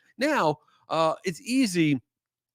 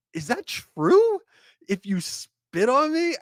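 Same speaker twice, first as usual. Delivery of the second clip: much higher, louder and slow in mock disbelief; incredulous; 11 semitones higher, 5 dB louder, slower than usual. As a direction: stretch it out like you can't believe it. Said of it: "I was like".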